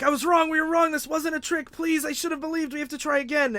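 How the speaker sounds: high-pitched mock pleading scream; retelling the hero screaming his apology in a high voice; retold screaming. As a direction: beg high and frantic, half-acted. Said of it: "he's screaming".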